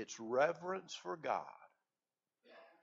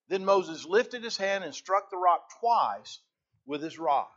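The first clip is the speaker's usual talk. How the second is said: ordinary delivery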